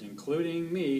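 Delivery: usual speaking voice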